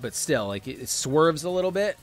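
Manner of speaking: ordinary talk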